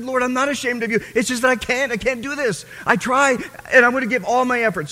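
high, loud pleading; desperate plea. Retold or imitated. Young man pleading with the Lord that he just can't. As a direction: beg to be understood.

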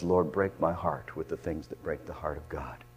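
soft, low murmured prayer; whispered plea to God; hushed prayer. Acted. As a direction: breathe the prayer quietly. Same prayer, now hushed.

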